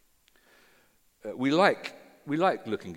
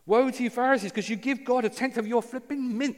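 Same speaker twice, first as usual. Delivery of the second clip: exasperated woe; 11 semitones higher, 4 dB louder than usual. Pretend. Jesus exasperated with Pharisees.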